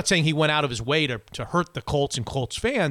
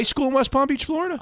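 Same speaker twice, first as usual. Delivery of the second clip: high disbelieving rise; surprised; 10 semitones higher than usual. Real, not acted. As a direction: squeak it out in disbelief. Amazed at shared birthplace.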